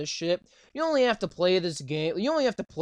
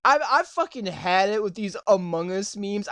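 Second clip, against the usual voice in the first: usual speaking voice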